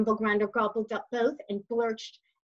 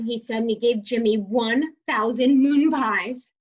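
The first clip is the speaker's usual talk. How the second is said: plain reading voice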